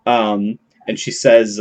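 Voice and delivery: ordinary delivery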